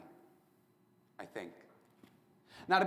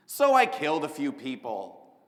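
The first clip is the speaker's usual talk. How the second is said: title announcement; 8 dB louder than usual. Acted.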